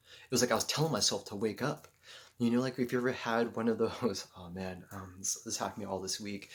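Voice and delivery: ordinary talk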